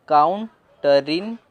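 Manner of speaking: slowly joined काउंटरिन; slow syllables. Shown as a demonstration. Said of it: "फिर इसे टी बोलेंगे". (काउंटरिन धीरे-धीरे जोड़कर)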